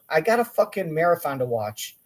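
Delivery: ordinary talk, nothing marked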